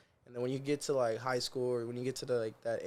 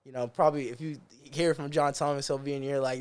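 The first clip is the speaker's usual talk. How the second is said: usual speaking voice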